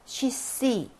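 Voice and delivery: "She see" as Russian, with "i" vowels and stressed "see"; model reading. Shown as a demonstration. Teacher rereads for students, "She see" with stressed end. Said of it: "다시 한번 읽어볼게요".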